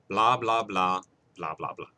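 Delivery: blah blah fading away dismissively. Real, not acted. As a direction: wave it off, trailing to nothing. Dismissive filler.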